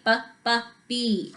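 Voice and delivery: "B, B" as short clipped letter sounds, then the name "B"; "B, B" said as phonics sounds before the letter name. Shown as a demonstration.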